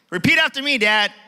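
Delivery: loud, high son coaching Dad; insistent command. Retold or imitated. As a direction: make Dad repeat after you. Son orders Dad to repeat.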